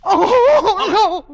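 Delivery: panicked shriek; terrified scream. Acted. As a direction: scream as he falls. Falling from the window.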